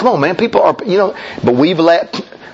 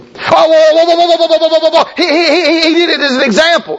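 hammed-up panicky objector, pitched way up; mock alarmed objection; 13 semitones higher than usual. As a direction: cut in frantically, stammering. Acts out alarmed objector cutting in.

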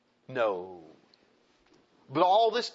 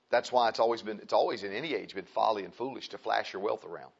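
normal talking voice

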